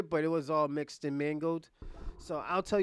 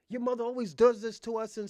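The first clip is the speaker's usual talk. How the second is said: mock complaint; 6 semitones higher, 6 dB louder than usual. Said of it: "she told the other dude that".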